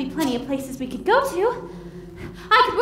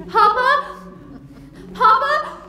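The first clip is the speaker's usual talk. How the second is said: anxious calling out; 11 semitones higher, 4 dB louder, slower than usual. Acted. Bride calling for her father.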